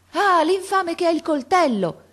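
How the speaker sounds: voce d'uomo molto più alta e forte, allarmata; grido d'allarme. A narrator's reading. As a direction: urla l'allarme correndo in aiuto. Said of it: "gridò un uomo accorrendo". (un uomo accorre e grida che Franti ha un coltello)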